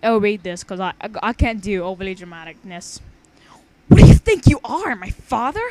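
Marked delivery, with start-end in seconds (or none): 3.9-5.6 loud, scornful sarcastic jab; heavy sarcasm. Acted. Girl sneering at her teacher, loud heavy sarcasm. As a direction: pile on the sarcasm, mockingly high. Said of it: "she replied with several extra pounds of sarcasm".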